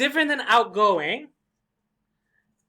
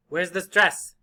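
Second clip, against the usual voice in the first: ordinary talk, nothing marked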